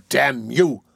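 ordinary delivery, nothing marked

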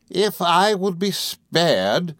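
high, very slow incredulous scorn; Rosa Dartle, fierce. A narrator's reading. Rosa echoing Emily's plea, fierce. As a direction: throw it back fiercely, drawn out. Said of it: "returned the other fiercely".

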